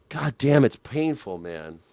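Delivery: ordinary delivery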